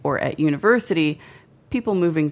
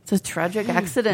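tentative, higher Irish accent attempt; lightly faked Irish; 4 semitones higher, faster than usual. Shown as a demonstration. Co-host tries the club's excuse, mild Irish accent. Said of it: "Do it in an Irish accent".

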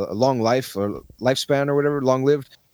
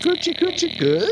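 high tickling falsetto. Retold or imitated. Tickling scene voiced.

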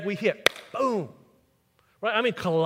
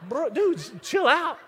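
urgent calming; 7 semitones higher, much slower than usual. Retold or imitated. Calming his brother.

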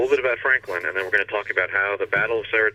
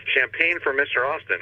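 higher-pitched, mild mock call; retold cry; 7 semitones higher than usual. Franklin calling to his butler, mild retold cry. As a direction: call out an order, lightly acted. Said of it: "he cries out to his butler".

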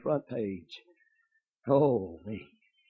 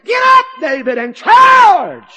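full-throated shouted charge; battle cry; 20 dB louder, much slower than usual. Acted. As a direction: roar it like a war cry. Preacher acting battle cry.

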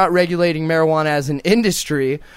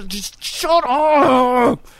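drawn-out mock shout; 6 semitones higher, much slower than usual. Mock yelling at naggers.

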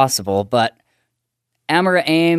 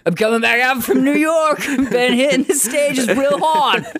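put-on Boston accent; 9 semitones higher than usual. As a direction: play a brash Boston guy. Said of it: "this Boston accent came through".

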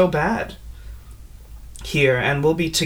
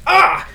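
sharp, very high, loud cry of pain; pained shout; 6 dB louder than usual. Acted. Sir M while branded, high loud cry. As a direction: yelp as the brand burns.

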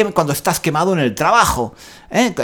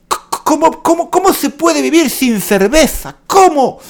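mock outrage; 11 semitones higher than usual. Despairs at having no beer.

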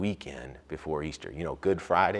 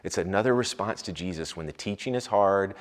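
ordinary talk, nothing marked

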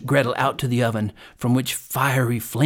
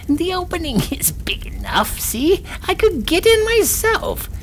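high crone voice, growing louder; scolding hag; 3 dB louder than usual. A narrator's reading. Hag shows Gretel the oven opening, crone voice. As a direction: show her up as a shrill, high-pitched witch. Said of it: "said the hag".

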